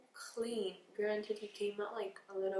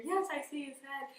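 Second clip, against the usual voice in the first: mom's voice, a bit higher and eager; excited mom; 4 semitones higher, 3 dB louder than usual. Retold or imitated. Mom at the bedside, eager imitated cheer. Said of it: "my mom was over here".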